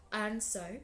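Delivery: ordinary delivery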